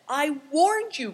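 high, loud cry; warning cry. A narrator's reading. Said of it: "cried Affliction".

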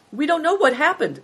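high-pitched, baffled reply; lightly voiced bewildered bystanders; puzzled onlookers. A narrator's reading. Kitchen staff answering the commotion, puzzled.

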